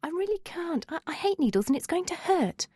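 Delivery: high-pitched, softened whine of reluctance; moaning. A narrator's reading. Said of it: "she moaned".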